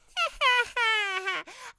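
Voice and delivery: high, loud crackling witch laugh; witch cackle. Acted. Old witch cackles while the kitten tickles her feet. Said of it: "crackled the old witch".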